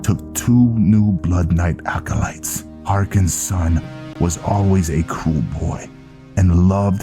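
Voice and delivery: Viking recounting a grim past. Acted. Viking reminding Velen of the ambush, solemn storytelling. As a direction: tell the old tale to a comrade, projected.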